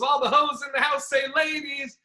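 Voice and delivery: crowd hype. Retold or imitated. Second crowd call-and-response.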